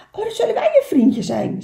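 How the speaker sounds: high, loud, quick reply; eager little beaver. Acted. Little beaver welcoming the otter, fast excited high voice.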